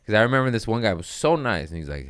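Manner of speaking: usual speaking voice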